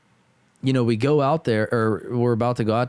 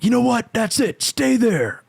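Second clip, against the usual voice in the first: high, louder angry outburst; mock furious; 14 semitones higher, 3 dB louder, slower than usual. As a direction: lose it and order him to stay. Boss losing temper, retold.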